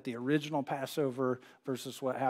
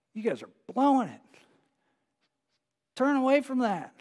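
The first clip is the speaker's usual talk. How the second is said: higher-pitched urgent plea, voiced as Jesus; urging them to turn back; pleading warning; 8 semitones higher, 4 dB louder than usual. Preacher voicing Jesus' warning.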